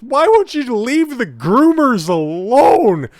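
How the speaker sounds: high, slow, whiny mock-crying woman; hammed-up high, slow weepy impression; mocking Jen Psaki. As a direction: mimic a tearful plea in a shrill put-on falsetto. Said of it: "her basic claim is just".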